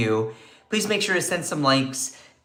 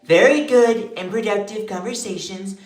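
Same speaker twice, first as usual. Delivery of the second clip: Trump impression; 3 semitones higher than usual. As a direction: puff up and proclaim like Trump. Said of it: "I can't do my Trump impression".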